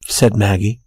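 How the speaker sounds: usual speaking voice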